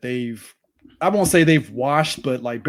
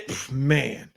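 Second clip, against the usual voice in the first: normal talking voice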